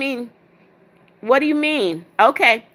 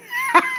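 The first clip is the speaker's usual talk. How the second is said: high burst of laughter; high, helpless giggle; laughing. Real, not acted. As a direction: crack up in real confusion. Cracks up at confusing chat comment.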